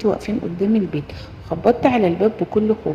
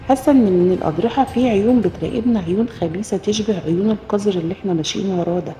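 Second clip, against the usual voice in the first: usual speaking voice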